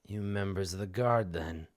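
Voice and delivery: low, quiet suspicious question; suspicious dog owner. Acted. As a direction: ask it low, sizing up strangers. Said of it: "he squints and he says".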